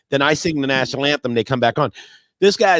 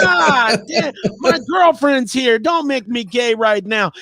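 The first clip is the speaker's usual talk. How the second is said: mock panic shout; 8 semitones higher, slower than usual. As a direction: yelp it out in fake alarm at a handsome player. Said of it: "And I'm like".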